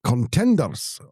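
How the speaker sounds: lightly put-on Scottish referee call; terrible Scottish accent. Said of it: "my terrible accent there".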